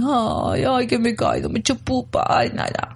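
little whiny hurt-child imitation; mock melancholic child. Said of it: "muy melancólico, muy tristón".

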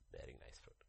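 a hushed whispered repeat. Acted. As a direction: breathe the words out softly. Preacher whispers the echo.

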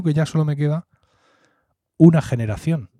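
ordinary talk

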